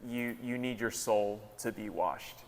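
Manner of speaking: acted sober counsel, softening toward the end. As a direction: tell him gravely and quietly, letting it sink. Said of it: "soberly telling him".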